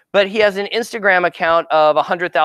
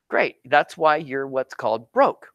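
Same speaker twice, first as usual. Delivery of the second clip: normal talking voice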